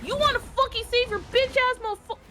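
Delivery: screaming insults in rage; furious shout. Real, not acted. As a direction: yell it at the enemy, furious. Raging at enemy in game.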